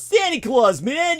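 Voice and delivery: loud, high Brooklyn shout; Brooklyn accent. Shown as a demonstration. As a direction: shout Santa Claus like a Brooklyn guy. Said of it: "with that Brooklyn accent".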